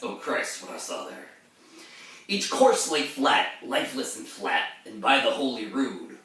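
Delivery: verse recital with a sharp rise on Oh Christ; heightened reading of ballad verse; dramatic poem reading. Acted. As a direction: read it as the haunted mariner. Rereads stanza aloud.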